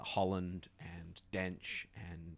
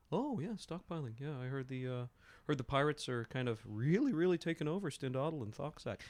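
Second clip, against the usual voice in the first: higher, teasing gossip; Zethil baiting; 8 semitones higher, 4 dB louder than usual. Acted. Zethil baits the guard about the pirates, higher and teasing. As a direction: coax the guard with a high, knowing voice. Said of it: "Zethil pushing the guard".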